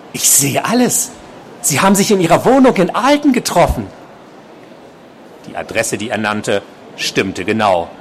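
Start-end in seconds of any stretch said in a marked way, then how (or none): 0.1-3.9 high, insistent stalker voice; obsessed patient, agitated. A narrator's reading. Patient revealing he watched the nurse, high agitated voice. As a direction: accuse her in a rising, high voice.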